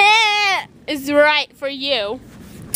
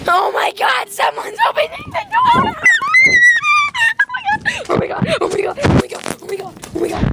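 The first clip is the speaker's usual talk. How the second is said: high frantic shriek; panicked shouting; much faster than usual. Real, not acted. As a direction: panic as the door opens. Someone opens the door.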